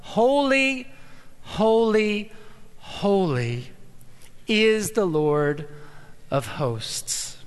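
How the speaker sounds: a touch of solemn proclamation, falling away at the end; lightly voiced angels' cry. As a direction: proclaim it like the seraphim, then let it sink. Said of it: "But it shows up".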